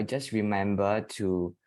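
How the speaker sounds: ordinary delivery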